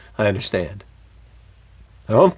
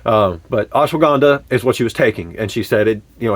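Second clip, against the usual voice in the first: ordinary talk, nothing marked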